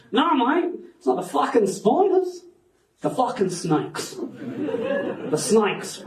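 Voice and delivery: mock Australian taxi driver; Australian accent. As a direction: put on a broad Australian accent. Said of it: "This is an Australian accent".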